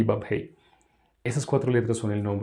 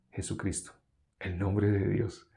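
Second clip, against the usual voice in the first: voiceless, hushed name; whispered reveal; 3 semitones lower, 8 dB quieter than usual. Real, not acted. (susurrado, como revelando un secreto)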